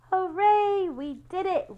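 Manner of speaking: high, bright cheer of triumph; a real little hooray; excited cheer. Real, not acted. Cheering finish with children.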